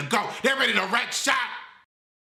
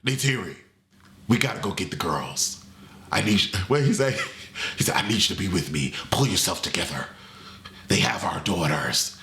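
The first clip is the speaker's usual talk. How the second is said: much lower, mock-serious hero voice; Jake's voice; 11 semitones lower than usual. Jake urging Neytiri to rescue their daughters, deep serious voice. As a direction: drop deep and play the stern dad. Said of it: "Jay Sully is like".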